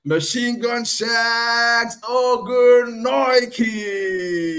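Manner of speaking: soaring, drawn-out fighter name call; frantic mock sports commentary. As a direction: build to a shouted name. Ring-style fighter name call.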